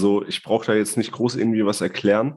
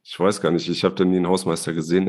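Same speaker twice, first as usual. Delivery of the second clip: usual speaking voice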